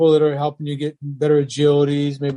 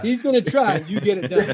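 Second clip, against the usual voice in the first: mock contrast; 6 semitones higher than usual. Shown as a demonstration. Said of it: "You understand the difference?".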